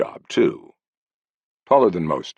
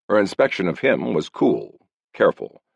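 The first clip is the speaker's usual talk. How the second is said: ordinary delivery, nothing marked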